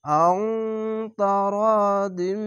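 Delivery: "আং" and "দিম" ending in long nasal hums; nasal ghunna. Shown as a demonstration. Teacher showing both ghunnas in the whole word, hums held. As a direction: hum the ng of "আং" and m of "দিম", stretch "র". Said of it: "এভাবে গুন্না হবে".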